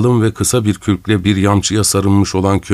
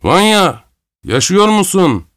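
calling out; 10 semitones higher, slower than usual. A narrator's reading. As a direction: call across the snow to a friend. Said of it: "ileriye doğru haykırdı".

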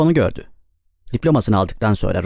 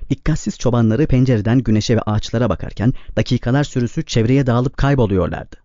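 ordinary delivery, nothing marked

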